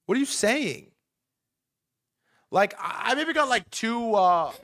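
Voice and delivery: incredulous high question; can't believe what he's hearing; baffled. Real, not acted. Reacting to the news, baffled and incredulous.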